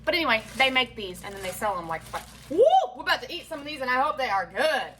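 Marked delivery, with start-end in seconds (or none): 2.5-2.6 short, loud rising woo; sudden loud "woo!" of anticipation; excited whoop. Real, not acted. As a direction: let out a quick, high whoop. Excited whoop about food.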